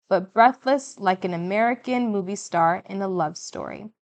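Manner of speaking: usual speaking voice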